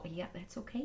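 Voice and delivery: soft whispered reassurance; hushed aside. Real, not acted. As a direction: whisper it to calm them. Reassuring after "Oh, no.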